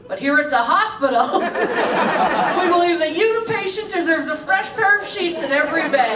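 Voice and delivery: loud, high mock ad slogan; parody ad announcer. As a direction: proclaim it as an outraged parody commercial. Parodies the ad as a hospital.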